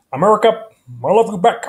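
a light, loud Obama address to the crowd; Barack Obama. Shown as a demonstration. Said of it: "Barack Obama's voice".

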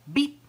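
short, high, loud peep; bird beep. A narrator's reading. Bird answering the barking dog, high beep. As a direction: chirp it like a bird. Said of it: "said Bird".

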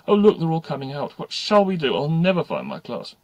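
lightly acted schoolgirl fluster; mild wail. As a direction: fret like a lost new pupil. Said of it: "Don't start bawling again".

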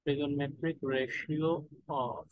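trigonometric ratio drawn out very slowly, rising at the end; slow dictation. Shown as a demonstration. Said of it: "repeated slowly for note-taking".

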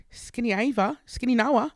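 imitating a daughter's Australian accent; tentative mock Australian. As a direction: have a go at an Australian twang. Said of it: "Can you do Australian?".

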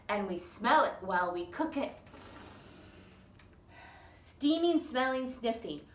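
lively, sing-song storybook reading; reading to children. Acted. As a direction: read it out bouncy for little ones. Reads picture book to toddlers.

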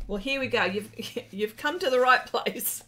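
normal talking voice

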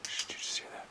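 urgent, very soft whisper; hushed whisper. Real, not acted. Ghost hunter asks companions about a noise, whispering. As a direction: shush them and whisper the question.

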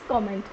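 comment as "KOM-ent"; correct pronunciation. Shown as a demonstration. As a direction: open "ko" at the start of comment. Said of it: "We will pronounce is". (comment को "कॉमेंट" बोलें)

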